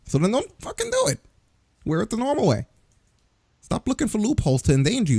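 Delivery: frustrated high outburst; fed-up ranting; exasperated. Real, not acted. Exasperated rant.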